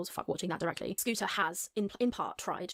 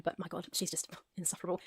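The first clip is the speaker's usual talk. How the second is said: whisper; 4 dB quieter than usual. Retold or imitated. Whispered aside in quote.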